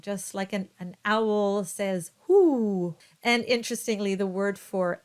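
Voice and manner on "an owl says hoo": hoo drawn out slow like an owl. Shown as a demonstration. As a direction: stretch hoo as an owl call. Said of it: "the letter U is a oo sound".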